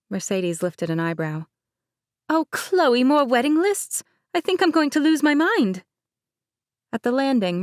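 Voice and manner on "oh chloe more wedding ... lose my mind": higher, louder mock despair; exasperated bride. Bride Mercedes groaning about more wedding lists. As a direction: throw up your hands, half laughing.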